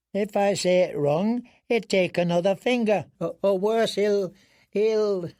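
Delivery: high, halting broken-off he'll, he'll; a terrified man who cannot finish the thought; frightened stammer. Acted. Prisoner dreading losing another finger, high stammer.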